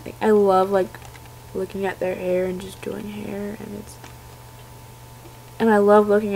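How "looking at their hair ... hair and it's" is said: soft unvoiced whisper. Real, not acted. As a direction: whisper it while admiring. Whispered while showing doll.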